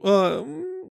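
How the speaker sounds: thin, rising cry that fades; high wail. A narrator's reading. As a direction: wail high, then trail into a hum. Anticipated cry of pain. (высокий затихающий стон)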